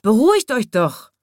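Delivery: shouted plea. A narrator's reading. Little zookeeper calming quarrelling animals, shouting. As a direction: shout over the squabbling. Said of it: "schrie der kleine Zoowärter".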